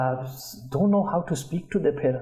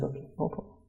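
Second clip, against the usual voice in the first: soft whisper; 12 dB quieter, faster than usual. Retold or imitated. Father quietly accepts.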